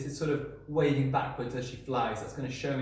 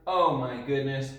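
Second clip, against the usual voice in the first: ordinary talk, nothing marked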